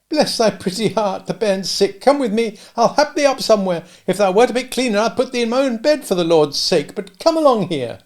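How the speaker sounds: put-on high kindly old dame voice; a cottage dame fussing over a boy. Old dame offers the sooty boy milk, high and kindly.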